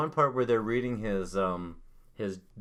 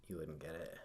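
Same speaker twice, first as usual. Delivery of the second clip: quiet; 6 semitones lower, 18 dB quieter than usual. Retold or imitated. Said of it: "he's like".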